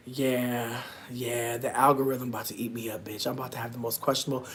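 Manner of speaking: low, quick, soft grumble; under-the-breath resignation; resigned muttering. Real, not acted. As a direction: mutter it fast to yourself. Grumbles to self about coming ads.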